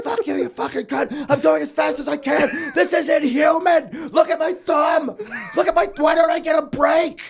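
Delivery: high, loud mock-furious shouting; to the elbowing coworkers, a high, loud mock-furious shouting rant; cursing at coworkers. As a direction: rant like an overworked kid losing it. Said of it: "just fucking cursing at 'em".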